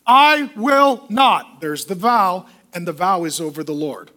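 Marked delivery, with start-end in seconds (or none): 0.1-1.6 slow, louder, higher, each word spaced out; defiant refusal. Retold or imitated. A stubborn believer refusing God's command, slow and defiant.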